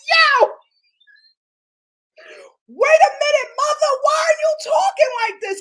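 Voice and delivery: loud, high-pitched disbelief; shocked outburst. Retold or imitated. Host reacting to a woman's threat, shocked outburst.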